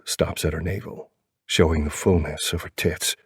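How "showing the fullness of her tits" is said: plain reading voice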